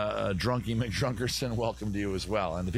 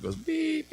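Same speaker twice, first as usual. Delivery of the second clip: truck beep. Retold or imitated. Truck backing up noise.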